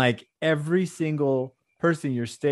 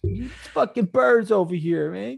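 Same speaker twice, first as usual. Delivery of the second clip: higher, hammed-up New York accent; fake New York accent; 6 semitones higher than usual. Co-host riffing for New York listeners in fake New York accent. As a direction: ham up a New York wiseguy. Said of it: "Our fake New York accents".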